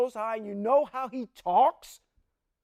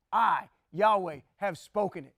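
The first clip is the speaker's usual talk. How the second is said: Yahweh held slow and weighty; slow solemn declaration; 3 semitones lower than usual. Shown as a demonstration. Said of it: "how he talks".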